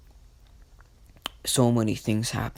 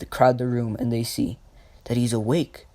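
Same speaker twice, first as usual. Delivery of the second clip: ordinary delivery, nothing marked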